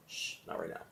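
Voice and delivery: gentle whispered hush; whispered shush. Shown as a demonstration. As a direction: breathe it out as a soft whisper. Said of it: "just gently say".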